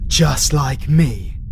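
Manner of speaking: normal talking voice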